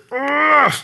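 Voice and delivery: strained, high-pitched held grunt; long high "ugh" through held-back tears; imitated child grunt. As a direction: mimic a hurt boy straining not to cry. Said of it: "He keeps grunting".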